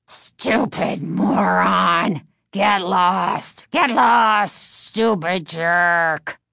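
slow, rasping puppet shouting insults; raspy dummy voice. A narrator's reading. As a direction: snarl like an evil ventriloquist dummy. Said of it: "the dummy rasped".

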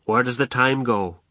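usual speaking voice

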